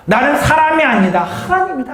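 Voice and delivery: proclaimed loudly. Shown as a demonstration. Preacher leads the congregation in the verse, loud and high. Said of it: "오늘 구 절 말해요".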